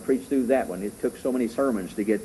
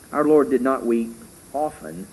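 usual speaking voice

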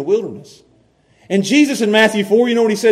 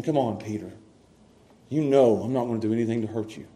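gentle reassurance; 9 semitones lower, 11 dB quieter, faster than usual. Acted. Jesus voiced gently to Peter.

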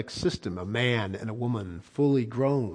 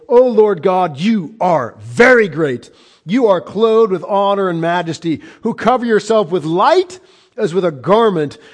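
solemn psalm proclamation; 6 semitones higher, 9 dB louder than usual. Acted. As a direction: proclaim each phrase with awe. Reads psalm again in proclamation.

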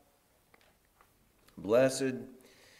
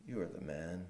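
much softer, lower, grieved; pained and sorrowful; 6 semitones lower, 13 dB quieter than usual. Retold or imitated. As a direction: say it gently, as if it hurts to say. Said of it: "he perhaps said it more painedly".

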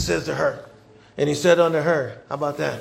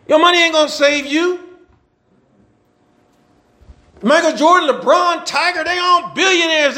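higher, louder, fast preaching with bursts of shouting; preaching at full force; 7 semitones higher, 5 dB louder than usual. Acted. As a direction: fire up the congregation, pushing hard.

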